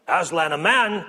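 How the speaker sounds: lightly voiced stern beaver, taken aback. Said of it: "said Beaver sternly".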